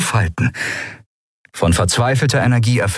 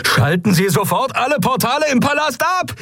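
high, frantic screech over sirens; shrieked order. A narrator's reading. Galet orders the portals shut over sirens, shrieking. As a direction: shriek the command over an alarm. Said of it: "kreischte Galet über die Sirenen hinweg".

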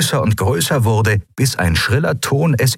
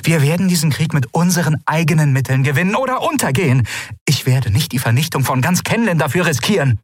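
resolute anger; 8 semitones higher, faster than usual. Acted. Ruler declaring resolve.

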